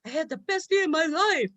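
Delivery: put-on tired happy kid. A tired kid home from Disneyland, blissful. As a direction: sigh it out like a worn-out happy kid. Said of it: "you're just like".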